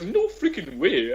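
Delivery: high, hushed, slow amazement; retold disbelief. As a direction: draw it out softly in recreated disbelief. Retelling stunned disbelief.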